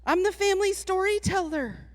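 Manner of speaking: put-on high, quick little-girl voice; a cheeky child talking back to grandpa, high and fast. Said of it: "and I was like".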